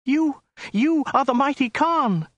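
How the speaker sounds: very high falsetto, frightened stammer; high-pitched panicky woman; terrified woman's cry. A narrator's reading. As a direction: cry out high in awe and terror. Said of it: "cried the woman".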